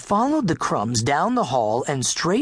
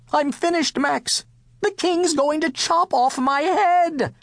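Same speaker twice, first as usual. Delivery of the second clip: despairing wail; 12 semitones higher than usual. A narrator's reading. Cook telling Max he is doomed, despairing wail. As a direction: wail it high as if all is lost.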